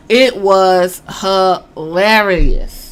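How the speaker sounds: each word of "it was hilarious" spaced out; emphatic verdict. Real, not acted. Fan summing up the wrestlers' clash, slow and emphatic. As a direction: stretch "hilarious" slowly, syllable by syllable.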